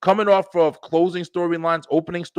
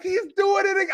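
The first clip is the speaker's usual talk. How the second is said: excited yell; 13 semitones higher than usual. Retold or imitated. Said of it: "I was like".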